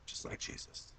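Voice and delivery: soft unvoiced whisper. Real, not acted. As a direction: breathe it as a sly aside. Whispered joke aside.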